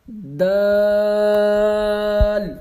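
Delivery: دال held long and simple; long, plain دال; drawn-out Dal. Shown as a demonstration. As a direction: stretch دال slowly, evenly. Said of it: "دال کو سمپل، آ، لمبا پڑھنا ہے".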